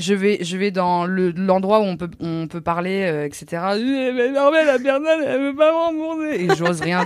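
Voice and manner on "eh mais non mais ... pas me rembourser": tearful plea. Retold or imitated. Retelling crying over lost money.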